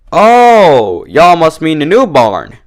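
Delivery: the penny drops; sudden realization. A narrator's reading. Applejack realizes the mix-up, surprised and loud. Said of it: "she realized".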